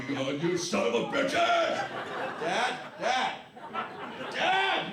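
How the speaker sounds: furious neighbor hollering for Dad; angry neighbor yelling. Retold or imitated. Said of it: "the big Polish guy".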